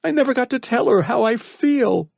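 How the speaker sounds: put-on boy crying as he says goodbye to his mom; tearful farewell. A narrator's reading. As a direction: say goodbye to everyone through tears. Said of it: "He sniffled and groaned".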